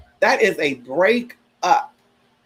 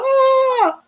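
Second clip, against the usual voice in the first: very high yelped cry; sudden high crying yelp; mock wail; 3 dB louder than usual. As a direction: burst out in a wail like bawling. Imitating herself bawling.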